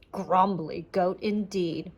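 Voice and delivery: ordinary delivery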